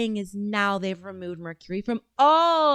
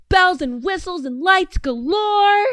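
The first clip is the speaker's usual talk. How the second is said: mock sales pitch; 8 semitones higher, 5 dB louder than usual. Real, not acted.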